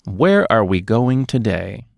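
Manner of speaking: ordinary talk, nothing marked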